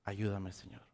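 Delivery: soft, pleading "Ayúdame, Señor" barely voiced; faint prayer to the Lord; hushed plea. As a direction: murmur the plea under the breath. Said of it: "Porque Pedro gritó".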